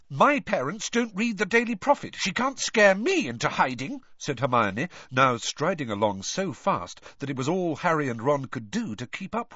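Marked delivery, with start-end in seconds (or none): 0.1-4.0 high, indignant defiance; in a rage. A narrator's reading. Hermione refuses to be scared, in a rage. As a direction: brush off the threat with heated defiance. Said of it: "Hermione in a rage like this".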